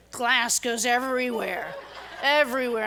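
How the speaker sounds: laughing disbelief as glass shatters everywhere. Real, not acted. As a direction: crack up retelling the glass.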